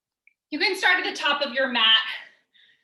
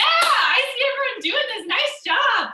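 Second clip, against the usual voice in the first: excited; 10 semitones higher, 4 dB louder than usual. Real, not acted. Coach cheering on the class.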